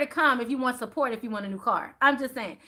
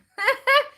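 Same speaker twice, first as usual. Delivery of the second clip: high laugh; 8 dB louder than usual. Real, not acted. Laughing after twenty-dollar joke.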